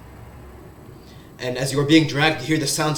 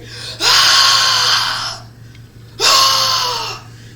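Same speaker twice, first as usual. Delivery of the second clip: a high, far-off scream of a prisoner; distant scream; 5 dB louder than usual. Acted. Prisoner screaming in distant stone halls, high scream. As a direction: let a tortured scream ring out from far away. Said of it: "You hear the sounds of screaming".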